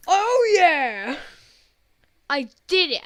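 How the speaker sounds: high loud triumphant cheer; excited cheer. Real, not acted. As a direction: celebrate beating the level. Celebrating a game win.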